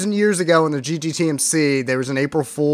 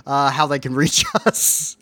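normal talking voice

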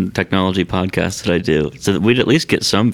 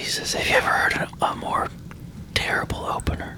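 hushed unvoiced whisper; 7 dB quieter than usual. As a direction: whisper so he won't hear. Hiding in closet, whispering.

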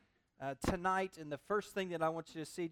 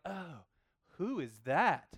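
intrigued who is that; 3 semitones lower, much slower than usual. Retold or imitated. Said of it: "exactly the tone that you get Boaz".

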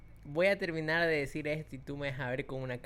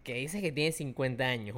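ordinary delivery

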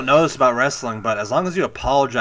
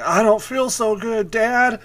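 higher, slow, fading plea; mock weak voice, higher and slow; dying Spider-Man; 10 semitones higher, slower than usual. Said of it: "He's like".

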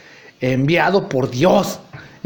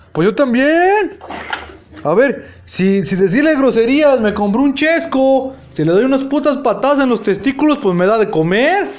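mock sarcasm; 6 semitones higher than usual. Narrator mocking, loud and slow.

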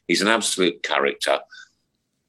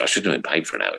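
ordinary delivery

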